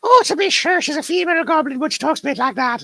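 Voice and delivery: very high Irish goblin lilt; Irish female goblin. Acted. Showing off a female goblin voice to the viewers, very high Irish accent. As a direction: play a little Irish goblin lady, far up in pitch. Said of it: "and she's Irish".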